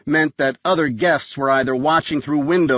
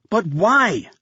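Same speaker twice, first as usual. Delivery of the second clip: desperate demand; 6 semitones higher than usual. A narrator's reading. Desperate demand to dying man.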